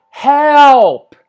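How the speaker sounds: high, loud yell for help from far off; cry for help. Retold or imitated. A drowning swimmer calls across the lake, loud high yell. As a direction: shout it like a man drowning out in the lake. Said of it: "I hear".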